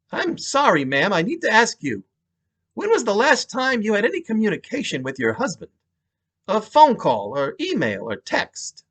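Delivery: a New York cop asking carefully; New York accent. Acted. Detective to widow, apologetic New York accent. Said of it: "He had a thick New York accent".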